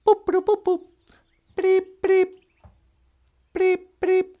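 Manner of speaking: high squeaky beep-boop radio sounds; beeping noises. Pretend. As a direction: mimic a little call signal, high and chirpy.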